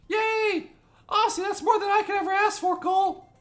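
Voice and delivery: very high put-on girly cheer; delighted girl character. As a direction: squeal it happily in a girl's voice.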